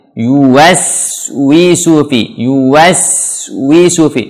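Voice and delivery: "Yuwaswisu fi" slow, rounded W, hissed S; fully pouted waw. Shown as a demonstration. Teacher modelling for learners, slow fully rounded "Yuwaswisu fi". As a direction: pout every W and U in "Yuwaswisu". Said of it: "Waw sempurnakan monyongnya".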